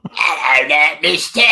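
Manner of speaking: high, loud Cockney devil voice; imitating a possessed boy in Cockney; mock Cockney devil. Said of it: "Cockney accent ... devil impression".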